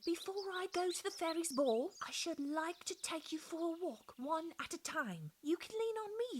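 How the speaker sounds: high, kindly offer; good-natured. A narrator's reading. Mamie offering the frail plants a walk, high and kindly. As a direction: offer help with cheerful kindness. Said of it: "she said good-naturedly".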